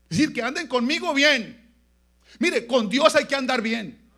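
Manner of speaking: loud, high-pitched exhortation; full-force preaching. Real, not acted. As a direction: drive each phrase home slowly and forcefully.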